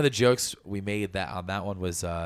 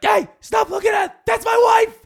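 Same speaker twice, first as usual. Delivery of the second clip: high, loud jealous yell; husband yelling at the burlesque crowd, high and loud; jealous shout; 9 dB louder than usual. Retold or imitated. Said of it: "and I'm like".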